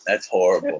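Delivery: normal talking voice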